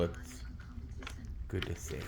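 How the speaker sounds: normal talking voice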